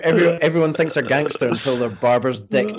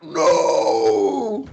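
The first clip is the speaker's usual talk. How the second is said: high mock scream, long drawn out; drawn-out retold yell; screaming actor; 8 semitones higher, 4 dB louder than usual. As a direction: imitate a man screaming his refusal. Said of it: "he's just screaming, like".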